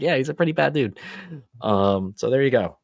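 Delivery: usual speaking voice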